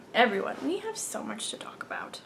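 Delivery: breathy whispered aside; a confiding hush. Real, not acted. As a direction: lean in and whisper to the audience. Aside to listeners.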